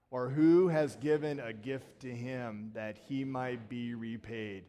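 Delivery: slow, measured congregational recitation of scripture; unison scripture reading. Acted. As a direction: read it together slowly, like a liturgy. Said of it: "Let's all say it together".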